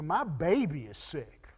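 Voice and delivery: low, soft anxious parent; hushed, heartfelt plea; tender pleading. Retold or imitated. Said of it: "you know the difference in that statement".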